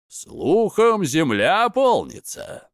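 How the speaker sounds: high, drawn-out knowing lilt; smirking. A narrator's reading. Underworld lord tells Svarog word gets round, smirking. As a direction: lift the pitch and stretch it with a smirk. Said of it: "ухмыльнулся владыка преисподней".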